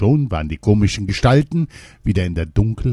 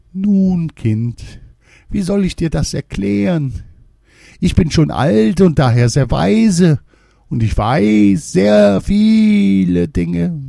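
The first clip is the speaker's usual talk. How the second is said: put-on old woman; 11 semitones higher, 5 dB louder than usual. A narrator's reading. Old woman explaining her wisdom to the maid, high crone. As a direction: boast like a wise old woman.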